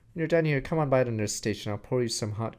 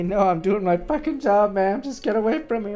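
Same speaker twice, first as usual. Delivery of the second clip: loud, higher irritated shout; annoyed yell; 9 semitones higher, 8 dB louder than usual. Real, not acted. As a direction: push her off in exasperation. Yelling at nurse.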